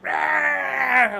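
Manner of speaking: loud, high roaring scream; naked man bursting from a couch, screaming; imitated scream. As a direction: roar it like bursting out of hiding. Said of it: "He's screaming".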